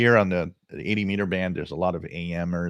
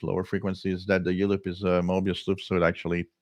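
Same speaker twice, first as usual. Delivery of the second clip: usual speaking voice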